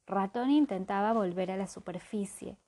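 plain reading voice

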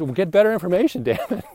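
exasperated comeback; retorting. Retold or imitated. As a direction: fire back quickly with a laugh. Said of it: "I said".

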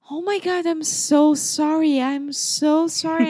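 high, flustered imitation of the apologetic neighbor; flustered high apology. Retelling the neighbor's flustered apology at the door, high and breathless. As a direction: squeak out the sorry like the flustered old lady.

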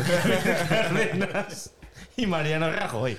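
put-on drawling pijo accent, laughing; posh Spanish accent. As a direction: play up a posh Madrid kid. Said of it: "llevo dos días haciendo acento, acento pijo". (acento pijo)

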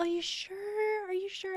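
high, slow, sing-song coaxing; high slow coaxing to the client about leaving; coaxing are you sure. Retold or imitated. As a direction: stretch it out high and teasing. Coaxing the sleepy client to stay.

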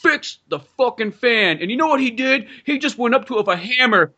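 high, worked-up caller voice; irate caller. Pretend. Aggrieved caller tells the show about a violent repairman, high-pitched outrage. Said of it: "I know how that goes, caller".

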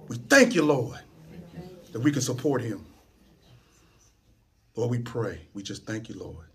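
very low, soft praying voice; quiet, reverent wind-down to amen; closing prayer. Real, not acted. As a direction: let the prayer sink to a hush. Closes the prayer.

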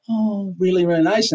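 lightly sugary mock praise; fake nice. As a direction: sound nice while you insult them. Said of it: "insult somebody who doesn't know the language".

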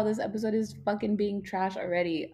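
ordinary delivery

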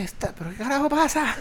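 high, soft, incredulous exclamation; mock bewildered outburst. Agent retelling his disbelief at the rude caller, high and incredulous. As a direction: act baffled at what is happening. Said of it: "Y yo dije".

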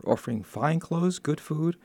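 ordinary delivery

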